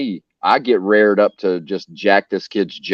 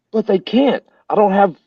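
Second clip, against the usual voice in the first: high-pitched retold panic; reenacted high-voiced objection; alarmed protest; 10 semitones higher than usual. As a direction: squeak out the protest in mock alarm. Retold panicked protest about deportation.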